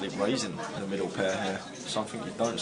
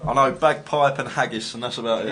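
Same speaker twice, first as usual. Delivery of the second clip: loud joking; 7 dB louder than usual. Real, not acted. Joking loudly about Scottish knowledge.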